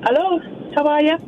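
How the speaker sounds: put-on Irish accent. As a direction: say hello with an Irish lilt. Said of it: "you've got an accent now".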